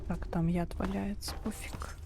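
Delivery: breathy whisper under the breath; whispered aside. Real, not acted. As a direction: drop to a whisper, dismissive. Muttered aside while playing.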